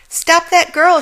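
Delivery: high, loud commanding shout. Acted. Checkout witch shouting after Helen, high and loud. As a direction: yell after the fleeing girl. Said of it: "shouted the checkout witch".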